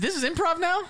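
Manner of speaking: high incredulous question; mock disbelief. Real, not acted. Host doubting his partner, high mock surprise.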